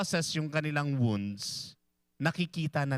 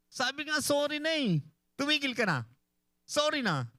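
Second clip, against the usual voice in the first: very high, sharp and impatient; exasperated spouse; 13 semitones higher than usual. Acted. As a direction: bark it like an angry partner who wants it over. Acted angry spouse demanding. (galit na asawang nagsosorry)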